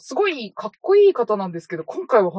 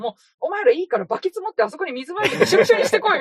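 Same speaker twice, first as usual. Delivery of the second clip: fast, higher mock barking of orders, laughing; director ordering; 8 semitones higher, faster than usual. Friends retelling a film scene, mock director ordering actors around.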